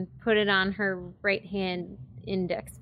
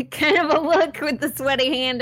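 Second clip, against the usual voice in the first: high, loud, laughing through the words; 6 semitones higher, 5 dB louder than usual. Real, not acted. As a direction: crack up while saying it. Player laughs at sweaty hand.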